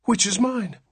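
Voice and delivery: high, anxious question. A narrator's reading. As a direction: ask with dread. Anxious father asks.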